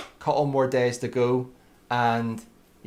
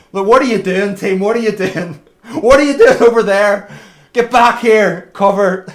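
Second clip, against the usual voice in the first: loud, frustrated shouting at teammates; yelling at team; 7 semitones higher, 11 dB louder than usual. Real, not acted. Streamer yelling at his racing team to get back in cover.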